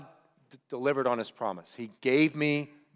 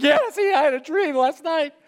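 high, louder, choked-up mock sobbing; weeping through the words; 13 semitones higher, 6 dB louder than usual. Pastor imitates himself weeping to his bosses, high and sobbing. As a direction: blurt it out through happy tears. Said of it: "And I was like".

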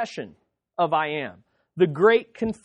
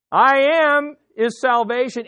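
loud, high call, long on I am; 5 semitones higher, 5 dB louder than usual. Acted. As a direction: cry out as if calling someone in from the yard. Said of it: "you would cry out".

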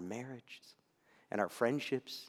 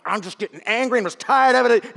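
higher, louder exasperated grumble; fed-up parent venting; inner frustration; 8 semitones higher, 13 dB louder than usual. Retold or imitated. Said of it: "I'm like".

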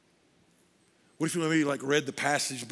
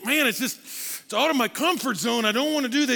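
higher, louder reluctance; uneasy man; 9 semitones higher, 6 dB louder than usual. Retold or imitated. Man refusing to worship openly, high and uneasy. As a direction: balk at leaving your comfort zone. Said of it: "You think".

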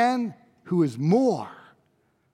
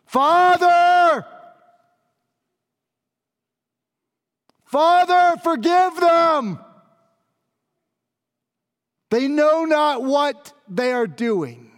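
high, loud, slow call to heaven; loud, lifted plea from the cross; crystal clear cry; 7 semitones higher, 4 dB louder, slower than usual. Acted. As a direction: cry out to the Father, ringing clear. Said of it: "said in a crystal clear voice".

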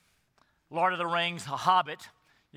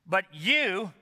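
"you" stressed and held; emphatic you. Shown as a demonstration. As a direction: lean hard on "you".

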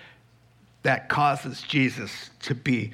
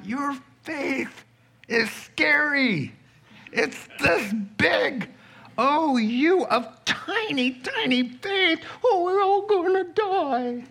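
much higher, hammed-up squeal of panic; mock fearful disciples; 11 semitones higher than usual. Preacher mocking Peter, James and John's panic, high mock squeal. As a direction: lampoon their fear in a silly high voice.

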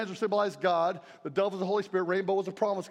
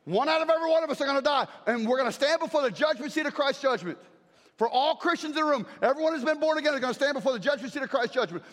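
raised, high preaching voice; 3 semitones higher, faster than usual. Real, not acted. Preacher warning congregation, full-force preaching. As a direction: proclaim it to the room.

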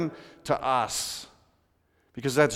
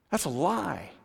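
usual speaking voice

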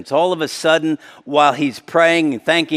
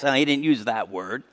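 normal talking voice

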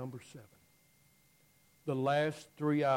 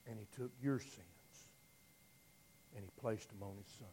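much softer, lower, reverent sermon hush; hushed reverence; 3 semitones lower, 6 dB quieter than usual. Real, not acted. Preacher telling of sins laid on the Son, hushed. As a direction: let it drop to a near-hush.

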